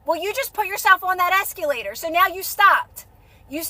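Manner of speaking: normal talking voice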